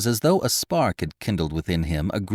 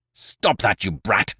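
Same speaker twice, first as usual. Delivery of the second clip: roared; slower than usual. A narrator's reading. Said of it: "roared Lupin".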